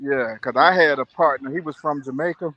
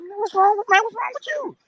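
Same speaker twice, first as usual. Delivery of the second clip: much higher squeaky imitation of his friend, half laughing; retelling his friend teasing him, in a high squeaky mock voice; high mock buddy voice. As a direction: play the friend in a high, mocking whine. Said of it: "He's like".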